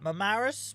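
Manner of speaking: Marmaris said loud and high, rising as a question; unsure pronunciation. Shown as a demonstration. Streamer reading a viewer's holiday spot, questioning Marmaris. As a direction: try out Marmaris, unsure how it goes. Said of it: "how do you say that?".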